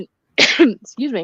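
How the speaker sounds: clearing throat after swallowing a bug; sudden throat clear, a little choked; just swallowed a bug. Real, not acted. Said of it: "I think I swallowed a bug".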